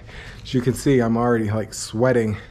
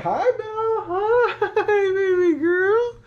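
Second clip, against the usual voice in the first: pet greeting; much slower than usual. Real, not acted. Owner greets the dog Belle, high-pitched baby talk. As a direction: coo it high to a beloved dog.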